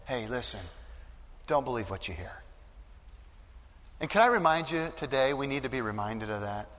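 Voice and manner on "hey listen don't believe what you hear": much softer, consoling hush; tender soft comfort; gentle reassurance. Acted. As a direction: arm around a grieving father, speak softly. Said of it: "putting his arm around Jairus and saying".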